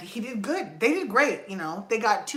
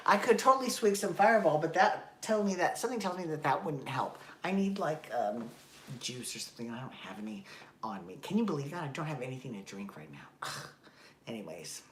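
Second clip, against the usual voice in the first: usual speaking voice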